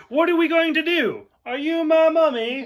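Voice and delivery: high, loud impression turning creepy child; David Tennant. Imitating the actor's ad-lib, high and loud. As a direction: pitch it up, urgent then eerie. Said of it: "So to see David Tennant".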